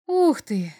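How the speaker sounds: put-on boy's amazed exclamation; surprised exclamation. A narrator's reading. Boy spots a little devil in the alien forest, surprised. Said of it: "снова удивлённо воскликнул мальчик".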